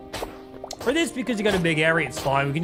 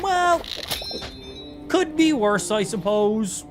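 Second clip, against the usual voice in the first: resigned shrug; 4 semitones higher, slower than usual. Retold or imitated. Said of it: "I was like".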